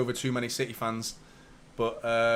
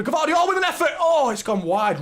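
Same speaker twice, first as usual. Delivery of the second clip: excited shot call; 14 semitones higher, 7 dB louder than usual. Real, not acted. Shot on goal, then a groan.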